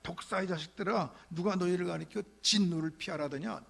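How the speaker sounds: loud, high rebuke of the brood of vipers; John the Baptist rebuking the Pharisees; fierce rebuke. Retold or imitated. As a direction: thunder the accusation at them. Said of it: "막 그러는 거죠".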